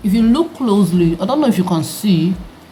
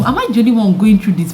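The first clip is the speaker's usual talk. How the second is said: normal talking voice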